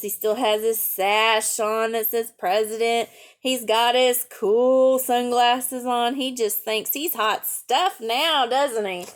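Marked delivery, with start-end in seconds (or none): none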